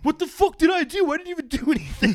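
very high, loud indignant shout; hammed-up aggrieved yelling; mock outraged protest. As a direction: screech it like a drunk being thrown out. Drunk guy protesting being thrown out.

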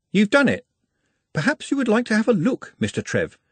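lightly voiced careful, formal young man; a hint of a nervous pupil; polite Nutt.

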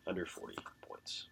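hushed whisper. Pretend. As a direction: breathe it like a secret. Dramatic whispered pick call.

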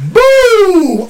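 sudden high shouted boom; loud boom. Retold or imitated. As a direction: hit it like a punch landing. Blow to the back of head.

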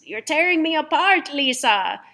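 high, loud melodramatic outcry; hammed-up anguished movie line; Tommy Wiseau. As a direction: cry it out like an overwrought movie star. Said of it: "where Tommy Wiseau goes".

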